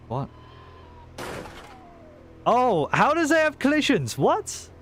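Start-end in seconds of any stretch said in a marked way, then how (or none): 2.4-4.8 high, stunned disbelief; surprised squeal. Real, not acted. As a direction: shriek of surprise. Sudden excited surprise in game.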